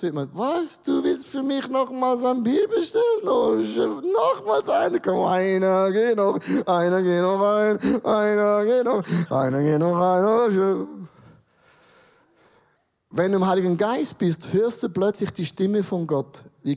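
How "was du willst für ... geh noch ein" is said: put-on drunk voice. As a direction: drag the words like a drunk. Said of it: "Wenn man betrunken ist".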